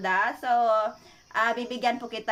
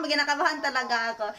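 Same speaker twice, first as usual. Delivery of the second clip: ordinary talk, nothing marked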